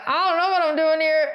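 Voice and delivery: high-pitched mock cluelessness; imitating clueless driver. George replying to Oscar at the stand, high mock bewilderment. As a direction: answer in a high, helpless voice. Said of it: "And George is like".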